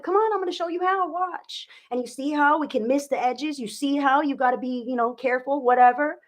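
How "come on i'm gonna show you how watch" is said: higher, gently coaxing parent voice; patient mom. Pretend. Mother reassuring child she'll show how, higher and coaxing.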